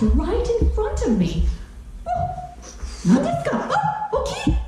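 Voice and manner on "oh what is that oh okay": much higher squeal of surprise; high astonished grandma; amazed. Acted. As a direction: shoot way up in wonder. Giant peach rolls up.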